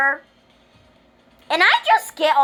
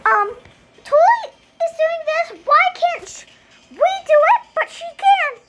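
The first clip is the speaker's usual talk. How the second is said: put-on tattling kid; 13 semitones higher than usual. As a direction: pitch way up and whine like a kid telling on a classmate. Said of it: "kids be like".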